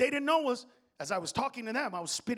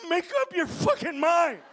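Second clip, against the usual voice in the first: slow, high, strained yell; 10 semitones higher, 10 dB louder, slower than usual. Acted. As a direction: bellow it with laboured, stretched words. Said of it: "They're gonna see you and go".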